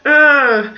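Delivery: re-enacted fed-up "ugh"; exasperated groan. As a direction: groan like a harried parent. Stressed mum's groan, re-enacted.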